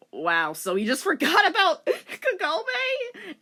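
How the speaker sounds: real amused disbelief; laughing disbelief. Real, not acted. Reader mocks the plot.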